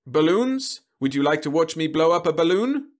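high, loud sugary pitch; robot salesman voice. Acted. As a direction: coax the child like a machine. Robot sells to child.

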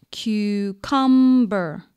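Cucumber very slow, in three long syllables; syllable demonstration. Shown as a demonstration. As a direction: stretch Cucumber into cu, cum, ber. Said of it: "How many syllables?".

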